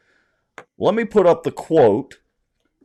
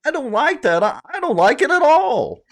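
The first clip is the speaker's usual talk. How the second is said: high, whiny mocking voice; mock her whining; 12 semitones higher than usual. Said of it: "I'll read it again in the non-whiny voice".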